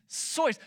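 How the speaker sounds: Seuss said "soyce"; drawn-out Seuss with an "oy" vowel; correct pronunciation. Shown as a demonstration. Preacher insisting on the correction, Seuss as "soyce". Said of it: "is actually Doctor Seuss".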